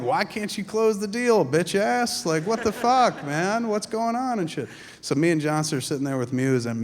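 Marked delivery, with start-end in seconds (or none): 0.0-4.7 higher, louder teasing jeer; laughing jeer, pitched up; mocking taunt. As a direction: rib a friend for striking out. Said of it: "mocked him relentlessly".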